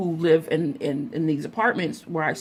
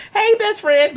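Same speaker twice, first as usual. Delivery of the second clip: excited greeting; 13 semitones higher, 6 dB louder than usual. Retold or imitated. Greeting best friend on phone.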